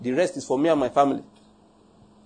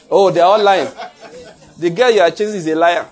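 laughing; 2 semitones higher, 3 dB louder than usual. Real, not acted. Preacher laughing at his own joke.

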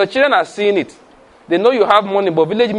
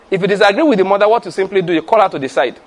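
ordinary talk, nothing marked

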